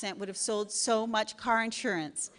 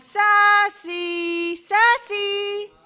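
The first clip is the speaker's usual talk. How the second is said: high, loud sing-song call of Sassy; ad jingle voice; 11 semitones higher, 11 dB louder, much slower than usual. Retold or imitated. Quoting a radio ad, loud sing-song call. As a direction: belt out the name like a cheesy ad. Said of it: "If I hear the ad".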